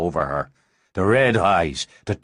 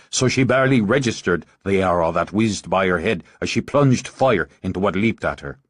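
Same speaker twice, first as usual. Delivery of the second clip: plain reading voice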